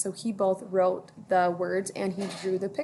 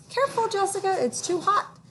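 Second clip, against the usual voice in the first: put-on much higher, caring little-girl voice; Ruthie's voice; 11 semitones higher than usual. A narrator's reading. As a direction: warn your pretend friend sweetly. Ruthie warning her imaginary friend.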